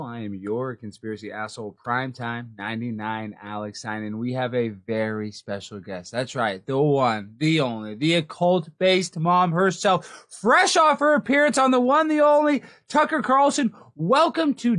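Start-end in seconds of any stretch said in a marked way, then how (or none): 0.0-7.3 deep announcer. Acted. Show intro in deep voice. 7.4-7.9 deep announcer voice. Acted. Low take of the intro. 10.3-12.1 hype announcer. Acted. Hyped guest introduction. 12.1-12.6 higher, louder hype intro; hype announcer. Acted. High hype take of the intro. 12.9-14.8 hype announcer. Acted. Hyped guest introduction.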